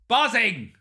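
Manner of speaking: "Buzzing!" called out high and loud; excited shout. Real, not acted. Fan thrilled by the transfer news, shouting with excitement. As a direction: burst out with the word. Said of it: "I am so excited".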